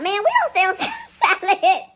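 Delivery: high, slow, laughing worker voice; a lightly voiced worker, giggling through it; mock drive-through worker. Voicing the McDonald's worker.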